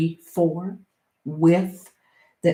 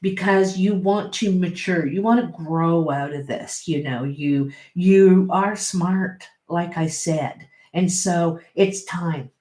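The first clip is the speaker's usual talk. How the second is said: ordinary talk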